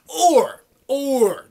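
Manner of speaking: OR hit high and loud, twice; emphatic build-up. Real, not acted. Vlogger setting up the big question, emphatic "Or".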